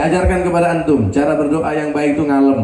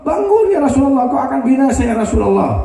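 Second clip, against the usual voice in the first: desperate crying out; 10 semitones higher than usual. Retold or imitated. Zaid crying out to the Prophet at Taif, high desperate yell. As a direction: call out to the fallen Prophet, voice straining high. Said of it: "beliau berteriak-teriak".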